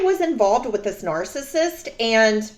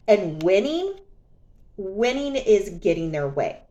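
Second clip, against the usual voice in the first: normal talking voice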